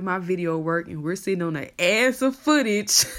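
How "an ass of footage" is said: laughing. Real, not acted. Laughing while saying it.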